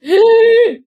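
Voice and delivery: high, loud gasp. Real, not acted. Player reacting to their own roll, loud gasp. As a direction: gasp sharply and loudly.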